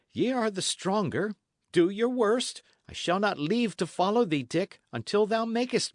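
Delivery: a stubborn lad refusing to leave; lightly voiced youth, dogged; young Matcham, stubborn. Matcham pleads with Dick.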